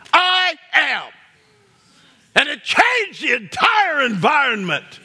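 rising sermon cry; preaching shout. Real, not acted. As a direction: preach it full force to the room. Preacher building to climax.

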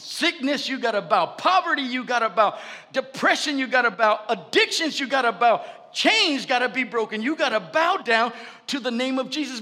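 loud, high commanding preaching; full force preaching. Real, not acted. Pastor commanding afflictions before the congregation, fervent shout. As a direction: command sickness to bow, building fervor.

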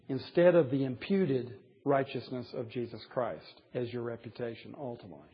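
ordinary talk

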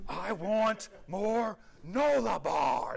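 put-on high, slow whining little girl; toddler whine. Dad voicing his little daughter's tantrum, high whine. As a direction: stretch it out like a two-year-old demanding a snack. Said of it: "That's my daughter".

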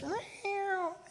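a pitiful squeak of a meow; tiny kitten meow. Retold or imitated. Pastor playing cornered Simba, a tiny high soft meow. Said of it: "He's got this".

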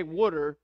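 water pronounced "wooder", drawn out; Philadelphia pronunciation. Retold or imitated. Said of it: "say water like, like them".

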